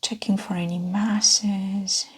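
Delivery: hushed, whispery clinician voice; ASMR exam role play. Pretend. Examiner checking a patient's belly, hushed ASMR whisper. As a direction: talk a patient gently through an exam, half whispered.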